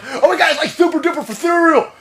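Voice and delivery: high hammed-up panic, laughing through it; high mocking doom voice with laughs; mocking the panicked left. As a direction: shriek in mock terror that everyone will die. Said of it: "you guys on the left like".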